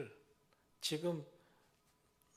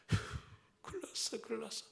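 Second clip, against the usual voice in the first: sighing, worried "큰일 났어"; sighing worry; 6 semitones higher, faster than usual. Retold or imitated. Parent sighing in front of the children, worried. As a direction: let the worry out on a sigh.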